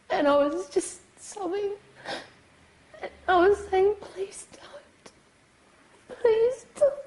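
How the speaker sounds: fighting back sobs while recalling it; pleading through real tears, mostly whispered; crying. Real, not acted. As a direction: voice breaking into a tearful whisper. Survivor recounting assault, breaking down.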